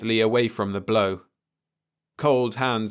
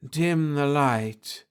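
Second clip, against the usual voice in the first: softly slurred; 5 semitones higher, much slower than usual. A narrator's reading. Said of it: "said a softly slurred voice".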